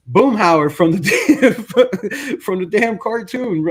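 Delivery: laughing. Real, not acted.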